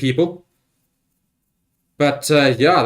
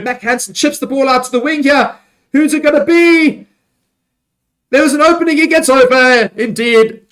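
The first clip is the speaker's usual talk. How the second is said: higher and louder, building to the try; excited play-by-play; 6 semitones higher, 3 dB louder than usual. Real, not acted. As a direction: call the attack as it breaks through. Live try-scoring play-by-play.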